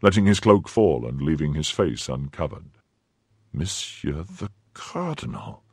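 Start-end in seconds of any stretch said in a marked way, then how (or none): 3.5-5.6 slow, soft astonishment; stupefied. A narrator's reading. Athos recognises his Eminence, stupefied. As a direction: let the words out softly in disbelief. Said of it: "cried the stupefied Musketeer".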